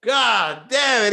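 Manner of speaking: loud, dragged-out groan of dismay; dismayed shout. Retold or imitated. Him reacting to [name]'s run, loud and drawn out. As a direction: cry out as the bad news sinks in. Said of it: "I was like".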